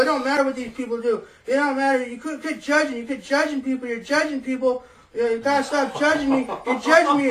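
put-on loud, high drunken rant. Drunk neighbor confronting the narrator, loud and high. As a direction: rail at him like an angry drunk. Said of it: "he's getting all in my face".

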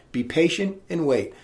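ordinary delivery

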